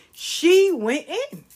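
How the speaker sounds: "She went in" high, loud and slow; drawn-out emphasis. Real, not acted. Grandmother marvelling at child's tirade, "She went in" drawn out high. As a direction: stretch "She went in" out loudly.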